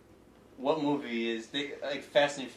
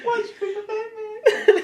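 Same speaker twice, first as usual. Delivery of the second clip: squeaky, teary laughing, slowed; 6 dB louder, slower than usual. Real, not acted. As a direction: laugh till you nearly cry. Laughing so hard it's teary.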